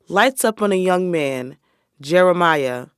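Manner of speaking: ordinary talk, nothing marked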